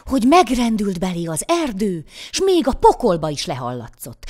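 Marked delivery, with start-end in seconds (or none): none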